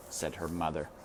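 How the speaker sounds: ordinary delivery, nothing marked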